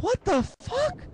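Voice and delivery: very high, drawn-out squeak; baffled disbelief. Retold or imitated. Son finds everyone mad at him, high incredulous squeal. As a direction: stretch it out and shoot up in mock disbelief. Baffled reaction to upset mom.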